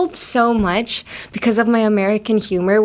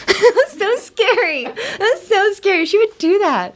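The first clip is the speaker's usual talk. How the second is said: much higher, laughing through it; 11 semitones higher than usual. Real, not acted. Laughing about the scary kindergarten teacher. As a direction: giggle while remembering.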